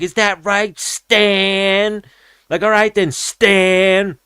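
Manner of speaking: very high, drawn-out mock threat; hammed-up annoyed fighter; imitating a fighter warning Stan; menacing retort. Annoyed fighter at wrong name.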